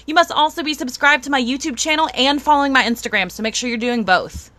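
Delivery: ordinary talk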